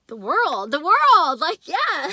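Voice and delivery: loud, high excited cry; thrilled loud outburst; excited exclaim. Real, not acted. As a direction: call it out in awe. Exclaims at the vision.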